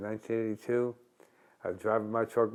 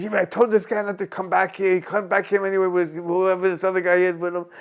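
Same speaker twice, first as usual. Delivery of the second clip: bartender complaint; 7 semitones higher, 5 dB louder than usual. Retold or imitated. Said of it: "He goes".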